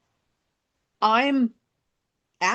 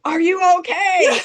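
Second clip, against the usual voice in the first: high, raised-voice yell; mock yell; 9 semitones higher, 5 dB louder than usual. Retelling husband checking on fallen wife, yelling. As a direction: imitate husband yelling over from a distance. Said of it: "He's yelling".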